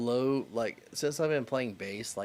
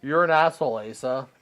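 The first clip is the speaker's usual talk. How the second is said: loud retort; 3 semitones higher, 12 dB louder than usual. Retold or imitated. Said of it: "he goes".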